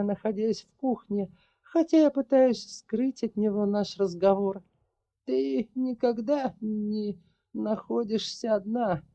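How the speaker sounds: put-on high, measured voice of an old witch. A narrator's reading. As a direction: voice the sorceress, calm and heavy.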